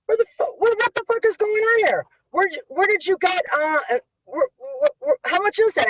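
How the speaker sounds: imitating dad tripping over his own words in shock; angry father. Father sees cash on table.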